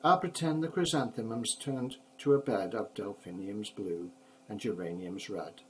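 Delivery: plain reading voice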